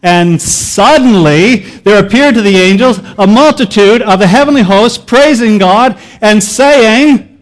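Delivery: loud, commanding soldier angel; military angel voice. Acted. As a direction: proclaim it like heavenly troops. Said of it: "with your best military angel voice".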